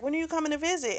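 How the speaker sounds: a hint of a homesick kid pleading; little girl asking. Granddaughter asking family on video chat, lightly voiced child.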